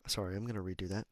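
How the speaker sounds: ordinary talk, nothing marked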